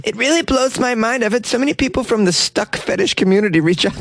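put-on high girlish quoting voice; young woman voice. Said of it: "humbled by the support".

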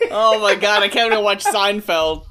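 loud laughing cry. Real, not acted. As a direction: yell it through laughter. Laughing along about Jerry.

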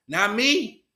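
ordinary talk, nothing marked

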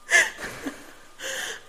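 high wheezy laugh. Real, not acted. Loud laugh.

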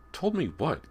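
high-pitched challenge; sharp rise into an insistent question; demanding. A narrator's reading. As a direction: demand to be told. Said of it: "Callie demanded".

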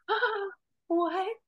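high, startled gasp of disbelief; replaying her own shock at who she was; shocked what.

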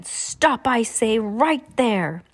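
high, ringing call to stop. A narrator's reading. Mister Poe calls out after Olaf, high and ringing. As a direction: call after the fleeing man. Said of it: "called out again".

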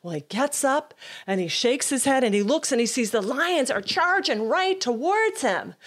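ordinary delivery

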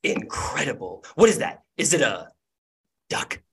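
breathless awe, pausing before the last word; awestruck gnome. Acted. Gnome sees the clay statue, stunned amazement. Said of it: "the gnome's jaw drops".

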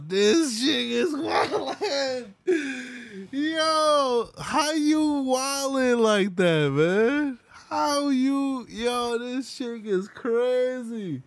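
stunned, laughing disbelief; incredulous laughing. Real, not acted. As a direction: drag it out, cracking up. Reacting to an allegation.

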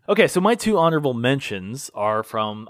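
ordinary talk

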